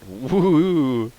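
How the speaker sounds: high yeehaw cheer; playful high cheering; cowboy whoop. Real, not acted. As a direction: whoop like a rodeo rider. Cheering the flight.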